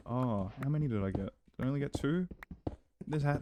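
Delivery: usual speaking voice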